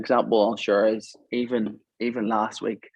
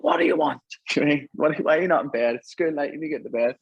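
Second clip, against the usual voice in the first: retold irritated scolding; 5 semitones higher than usual. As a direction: snap at the kid for being out of bed. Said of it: "I was shouting at him".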